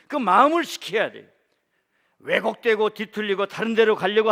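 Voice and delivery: loud, driving sermon cadence; fervent preaching. Acted. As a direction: preach it with rising force. Preaching at full force.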